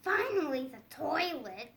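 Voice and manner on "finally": ordinary delivery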